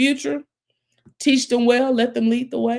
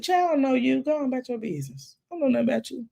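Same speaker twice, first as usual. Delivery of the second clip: Lord dismissing; 6 semitones higher, 4 dB quieter, much faster than usual. Retold or imitated. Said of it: "The Lord said".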